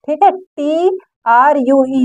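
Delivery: ordinary talk, nothing marked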